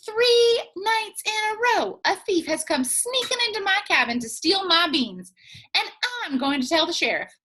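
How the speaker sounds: put-on high, loud indignant old woman; old Granny voice. Granny telling the snake about the thief, high and indignant. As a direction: complain like a fussy old granny. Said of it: "she answered".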